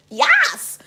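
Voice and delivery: high squealed yes; gleeful yes. Real, not acted. Reader answers Kirsten's question with a high gleeful squeal. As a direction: shriek the yes in delight.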